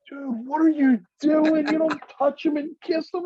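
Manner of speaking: laughing squeal of disbelief at his friend; horrified high yell. Retold or imitated. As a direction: shriek it out in giggling horror. Friend kisses corpse, he panics.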